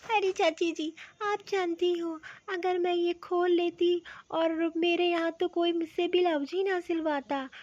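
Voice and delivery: ordinary talk, nothing marked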